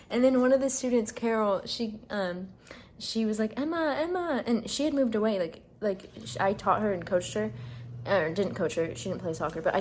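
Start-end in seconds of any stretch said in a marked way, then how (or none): 3.6-4.4 eager calling. Retold or imitated. Student calling her name eagerly.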